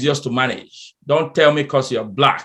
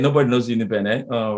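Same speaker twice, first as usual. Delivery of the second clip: usual speaking voice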